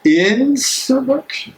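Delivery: stunned echo of the last word; incredulous question. Acted. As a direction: throw the word back at him in disbelief. Incredulous reply to Bell's demand.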